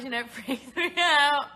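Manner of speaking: loud, high, laughing protest; laughing exasperation. Real, not acted. Host telling fans to stop video requests, laughing exasperation. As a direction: blurt it out loudly through a laugh.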